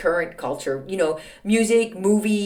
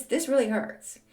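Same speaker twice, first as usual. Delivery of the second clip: ordinary delivery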